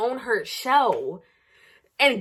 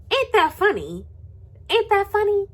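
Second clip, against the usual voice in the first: high, taunting sarcasm; gloat, pretending to find it funny; high sing-song taunt; mock amused; 10 semitones higher than usual. Real, not acted.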